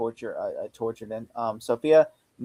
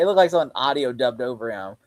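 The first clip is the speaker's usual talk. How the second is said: normal talking voice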